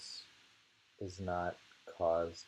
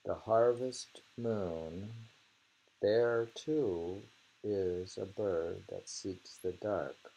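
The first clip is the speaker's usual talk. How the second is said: poem reading; 4 dB louder, slower than usual. Shown as a demonstration. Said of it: "What did you say?".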